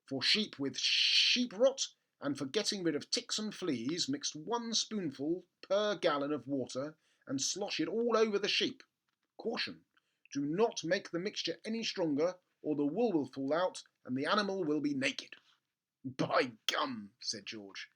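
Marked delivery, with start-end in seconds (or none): 0.1-15.4 label read. Acted. Reading the label aloud. 16.0-17.1 whispered exclamation. Acted. George's gleeful exclamation.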